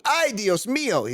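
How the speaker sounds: high, exclaimed mock Spanish; mock Spanish accent. Host ribbing the fake Spaniard, in mock Spanish accent. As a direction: cry it out in an exaggerated Spanish accent.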